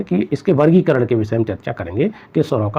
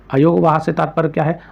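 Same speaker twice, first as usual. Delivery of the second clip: ordinary talk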